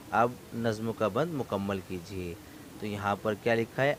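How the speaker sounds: usual speaking voice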